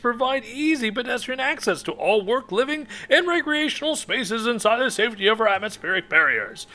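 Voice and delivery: ad read. Acted. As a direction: sell it like a radio ad. Reads an in-game ad aloud.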